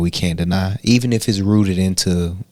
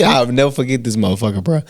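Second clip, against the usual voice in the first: ordinary talk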